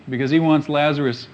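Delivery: ordinary talk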